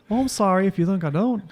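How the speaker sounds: usual speaking voice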